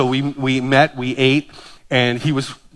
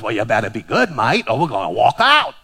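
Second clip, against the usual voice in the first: put-on Australian accent; 7 semitones lower than usual. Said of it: "He goes".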